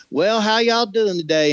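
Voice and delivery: high-pitched, horribly bad Southern accent; over-the-top Southern accent. Shown as a demonstration. Actor overdoing it at an audition, exaggerated Southern accent. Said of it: "horribly bad Southern over the top accent".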